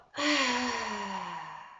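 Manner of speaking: long breathy sigh out; audible exhale sigh. Shown as a demonstration. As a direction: sigh it all out. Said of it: "sigh it out".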